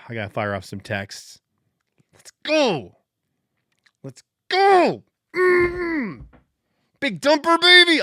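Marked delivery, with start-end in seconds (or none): 2.1-8.0 high, louder cheering, voice cracking; real celebratory yell. Real, not acted. Fan cheering his catcher's homer, ecstatic. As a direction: scream it like the ball left the park.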